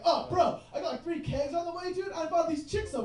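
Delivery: bro voice. Retold or imitated. Comic mocking a frat bro bragging about kegs, mock bro voice. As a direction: brag like a try-hard party bro. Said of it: "You're like".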